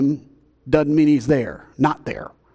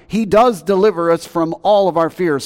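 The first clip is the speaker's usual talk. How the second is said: loud preaching; 5 semitones higher, 5 dB louder than usual. Acted. Preaching climax.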